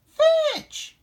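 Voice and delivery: very high, loud excited call. A narrator's reading. Lassie throws the ball to the pup, high excited shout. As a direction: shout it high across a field. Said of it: "Lassie called as she threw".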